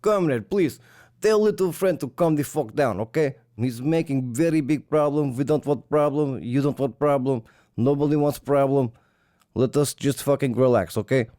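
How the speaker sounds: a Russian pleading with a comrade to calm down; hammed-up Russian accent. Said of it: "They're like".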